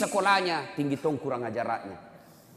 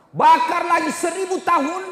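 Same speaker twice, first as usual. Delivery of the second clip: preaching at full force; 11 dB louder than usual. Acted. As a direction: drag it out like a warning of doom. Fiery sermon on hell.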